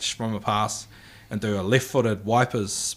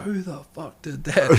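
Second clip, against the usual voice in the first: angry coach; 6 semitones higher than usual. Retold or imitated. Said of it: "he stormed down the field 'cause he was like".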